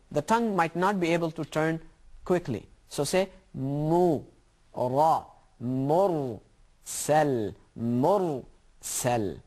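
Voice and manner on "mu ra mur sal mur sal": Mur and Sal spoken slowly, syllable by syllable. Shown as a demonstration. Teacher breaking Mursal apart for slow learners, syllable by syllable. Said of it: "break the words".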